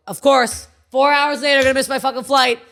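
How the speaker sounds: loud, high, fed-up complaint; acted-out exasperated rant. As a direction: rant aloud for everyone to hear. Retold airport rant aloud.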